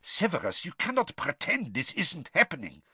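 anxious, high, keen not to be overheard; urgent nervous plea, half-hidden; anxious and hushed. Acted. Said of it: "voice sounded anxious and hushed".

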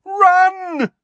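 very high, loud shout. A narrator's reading. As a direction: shout the order to flee. Harry bellowing to crowd.

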